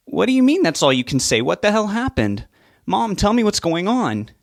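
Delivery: insist, voice rising; angry demand. Acted. Son demands answers.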